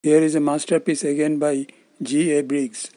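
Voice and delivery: ordinary talk, nothing marked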